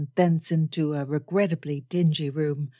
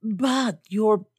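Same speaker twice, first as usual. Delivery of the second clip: mild shock, higher, breaking off; shocked protest, cut off; 4 semitones higher than usual. A narrator's reading. Old gentleman interrupted before naming her title, shocked protest. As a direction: start a protest and stop short. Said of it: "say in shocked protest".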